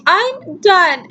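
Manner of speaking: high, retold protest; high-pitched, exasperated declaration; fed-up outburst. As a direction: imitate giving up in a huff. Retelling her upset outburst.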